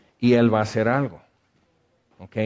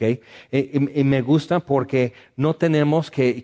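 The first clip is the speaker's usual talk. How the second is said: ordinary talk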